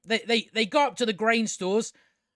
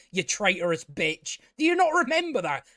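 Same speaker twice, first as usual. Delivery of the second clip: usual speaking voice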